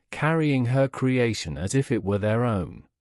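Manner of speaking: ordinary delivery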